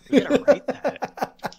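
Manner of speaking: loud, high, slow joking shout; excited shout. Real, not acted. To a friend about a song, loud excited shout. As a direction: hype it up like a new song idea.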